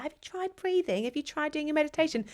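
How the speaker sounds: high, mocking sing-song of a boyfriend giving advice. Girlfriend mocking her boyfriend's unwanted advice, high mock voice. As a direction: parrot his helpful advice back with scorn. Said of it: "I don't want you to be like".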